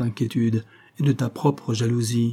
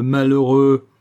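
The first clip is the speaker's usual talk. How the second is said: louder anguished cry of malheureux; 7 dB louder, slower than usual. Acted. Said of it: "malheureux repeated".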